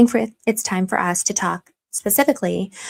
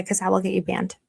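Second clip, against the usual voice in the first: ordinary delivery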